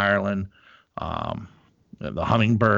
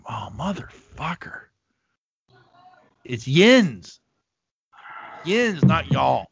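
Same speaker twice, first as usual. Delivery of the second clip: exasperated correcting; 4 semitones higher than usual. Retold or imitated. Retold exasperation at friend's accent.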